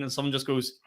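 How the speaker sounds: ordinary talk, nothing marked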